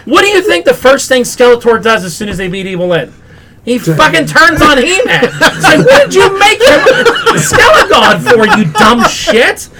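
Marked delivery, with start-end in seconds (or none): 3.7-9.7 loud, high, laughing disbelief at the dumb choice; half yell, half laugh at the stupidity; laughing outrage. Real, not acted.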